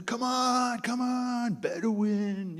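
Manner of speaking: high, drawn-out calling-out; mock yelling. Palestinians yelling down to the slow Bedouin, impatient. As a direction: imitate runners yelling down from a hilltop. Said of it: "they're yelling at him".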